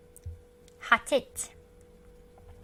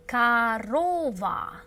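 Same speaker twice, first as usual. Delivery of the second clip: slow, louder, each syllable clear; slow model word; 5 dB louder, much slower than usual. Shown as a demonstration. Teacher modelling the word slowly for learners. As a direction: lay it out slowly for the class. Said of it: "The first O is not stressed".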